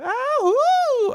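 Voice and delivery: a high, loud whoop of delight. Real, not acted. Cheering to viewers about his new YouTube channel, high delighted whoop.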